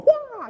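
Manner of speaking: sudden high, loud karate cry; mock kung fu yell. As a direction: let out a hammed-up martial arts battle shout. Mock kung fu street fighter.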